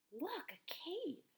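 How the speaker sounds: put-on a kid amazed at finding a cave; excited discovery. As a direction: point it out in awe.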